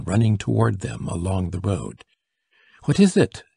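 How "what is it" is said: high, louder, anxious question; urgent cry. A narrator's reading. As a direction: cry it out in sudden alarm. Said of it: "they cried".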